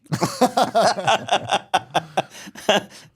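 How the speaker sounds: loud high burst of laughter; big laugh. Real, not acted. Telling a jail story, bursts out in high laughter.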